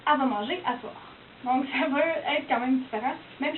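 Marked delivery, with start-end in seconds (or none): 0.1-0.9 "elle va manger à soir" with "elle" clipped to "a", Québécois style; casual Québécois accent; Québec French. Shown as a demonstration. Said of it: "On va dire au Québec".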